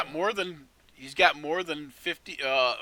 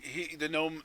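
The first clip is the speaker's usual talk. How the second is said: normal talking voice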